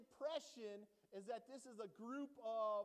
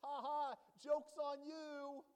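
gloating taunt; 5 semitones higher, 4 dB louder than usual. Pretend.

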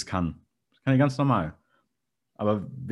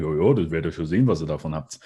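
offhand brush-off, fast; dismissive; 7 semitones lower, faster than usual. Retold or imitated. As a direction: wave it away carelessly. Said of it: "nicht einfach sagen".

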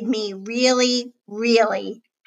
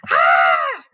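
short loud scream of alarm; acted shriek of fright; startled yell; 5 dB louder than usual. A narrator's reading. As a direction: yell "Ah!" as if the lights just died. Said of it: "yelled Zoe".

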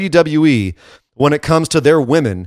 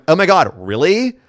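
higher mock Valley Girl, rising at the end; Valley Girl accent; 4 semitones higher than usual. Podcaster mimicking a wrestler's accent, mock Valley Girl. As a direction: imitate an incredulous Valley Girl. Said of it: "she has a Valley Girl accent".

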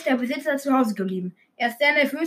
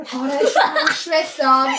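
normal talking voice

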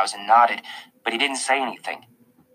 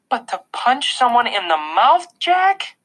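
high, upset mother's outburst; raised voice; 13 semitones higher, 4 dB louder, slower than usual. A narrator's reading. Mom to son who punched someone, voice raised. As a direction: raise your voice in disbelief. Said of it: "raising her voice".

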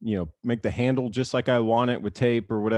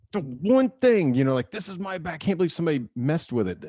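high, strained mock indignation over his bat; replaying his outrage, pitched way up; retold frustration; 6 semitones higher than usual. Ballplayer retelling finding his bat cracked, mock outraged.

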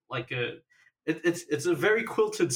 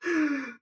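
mock gasp; 13 semitones higher than usual. Mock shock at choking.

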